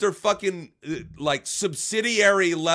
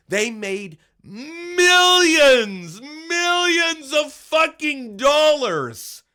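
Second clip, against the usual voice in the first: stretched out, high shouted outrage; a real furious shout, higher and louder; 9 semitones higher, 5 dB louder, much slower than usual. Real, not acted. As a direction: yell it slowly and high, pitch far up. Furious rant at Rob Rundo.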